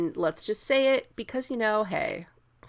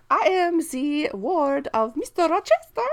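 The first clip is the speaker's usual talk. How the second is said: much higher, hammed-up little French girl; a squeaky, eager child playing it up for laughs; 10 semitones higher, 4 dB louder than usual. Mocking Adele's lines to Jane, in a high, hammed-up child voice. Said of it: "then she's like".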